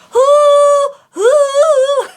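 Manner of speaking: loud high hoo; high head voice. Shown as a demonstration. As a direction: ring out high. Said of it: "This is head voice".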